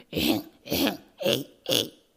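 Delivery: loud, low mock coughs; throat clearing. A narrator's reading. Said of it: "非常に慌てて".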